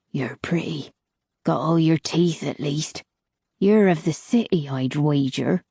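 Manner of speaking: put-on a weathered old woman listing the desert's dangers; old crone. Old crone sizing up Nilith.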